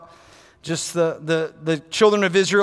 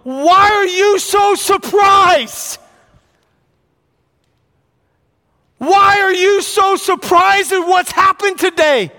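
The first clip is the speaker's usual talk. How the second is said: higher, louder, slow challenge; Peter's challenge; 10 semitones higher, 6 dB louder, slower than usual. Retold or imitated. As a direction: confront the crowd as Peter. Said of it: "I'm like Peter going".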